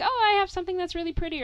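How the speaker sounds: high, quick excited mom imitation. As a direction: gush like a mom about her garden.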